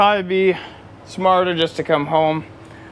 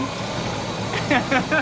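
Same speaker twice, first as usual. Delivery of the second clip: breathy laugh; 11 semitones higher, 4 dB quieter than usual. Real, not acted. Laughing at the repair.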